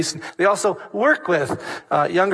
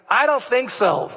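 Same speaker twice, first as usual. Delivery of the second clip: scoffing dismissal; 6 semitones higher than usual. Acted. Preacher dismisses crystal-ball reading.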